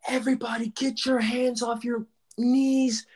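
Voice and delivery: high, slow yelled order; strength coach yelling. Retold or imitated. Strength coach yelling at the gassed team, high slow shout. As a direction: bark it down at the team like a strict coach. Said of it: "She's like".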